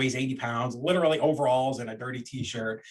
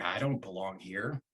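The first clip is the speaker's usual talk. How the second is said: nervous; 5 semitones lower, 7 dB quieter, slower than usual. Retold or imitated. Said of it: "I'm too nervous".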